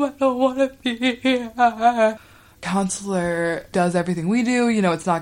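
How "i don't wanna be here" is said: high, slow mock wail; crying. Homesick girl wails to the counselor, high mock crying. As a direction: drag it out like a bawling kid. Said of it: "with her crying".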